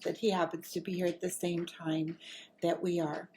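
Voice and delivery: ordinary delivery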